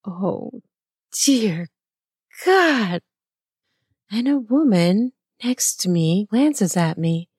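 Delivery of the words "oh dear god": drawn out, word by word; gasped exclamation. A narrator's reading. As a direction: gasp it out with pauses between words. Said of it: "I gasp and say".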